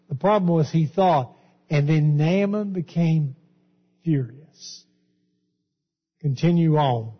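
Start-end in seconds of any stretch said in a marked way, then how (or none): none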